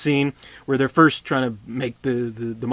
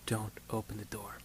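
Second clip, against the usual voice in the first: hushed warning; 14 dB quieter than usual. Retold or imitated. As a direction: drop it to a soft hush, "don't open the door". Doctor's final soft warning.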